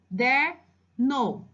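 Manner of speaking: slow CADERNO split into ca-der-no with pauses; syllable by syllable. Shown as a demonstration. Teacher showing how the child reads with a finger, syllable by syllable. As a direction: sound out CADERNO as if pointing at each part. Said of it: "ver como ele colocou o dedinho".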